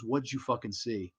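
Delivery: soft, low threat; menacing hush. Retold or imitated. Esther threatening her little brother, low menacing hush. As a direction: lean in and threaten under your breath.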